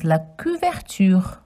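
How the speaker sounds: la couverture with French u and throaty r; French pronunciation. Shown as a demonstration. Teaching children la couverture. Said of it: "Blanket in French is".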